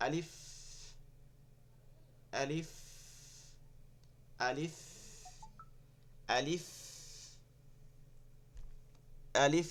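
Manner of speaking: "আলিফ" short, then a long airy f; breath-released final f. Shown as a demonstration. Teacher drills the letter for students, air released at the end. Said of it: "বাতাস বের হচ্ছে".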